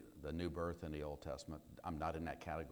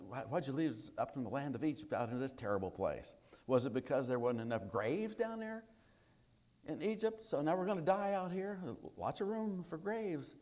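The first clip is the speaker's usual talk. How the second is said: high, whiny-edged mock grumbling; smart-aleck complaining; 7 semitones higher than usual. Israelites grumbling to Moses in the desert, smart-aleck complaint. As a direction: complain sarcastically, pitch climbing toward the end. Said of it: "and they start complaining".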